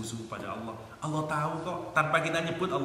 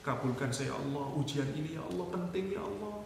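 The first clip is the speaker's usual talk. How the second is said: mock tearful plea; 7 dB quieter than usual. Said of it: "Bener kita nangis".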